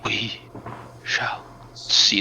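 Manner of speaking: we shall see, breathed as a whisper; hushed echo. Acted. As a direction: let it fade to a whisper. Meningian repeats softly.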